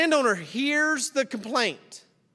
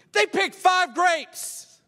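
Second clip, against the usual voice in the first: slow, higher, sneering; scornful; 6 semitones higher, much slower than usual. Acted. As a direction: drag it out with contempt. Workers mock latecomers' tiny harvest.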